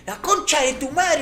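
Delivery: high-pitched retold curse; mock enraged swearing; imitating hot anger. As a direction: curse high, mimicking a furious boy. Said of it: "se levanta así recaliente".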